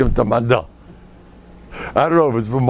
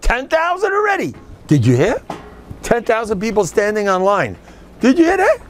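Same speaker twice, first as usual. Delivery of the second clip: excited boast; 10 semitones higher than usual. Real, not acted. Boasting about the crowd.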